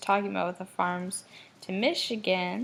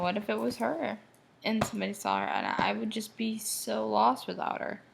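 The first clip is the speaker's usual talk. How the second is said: ordinary delivery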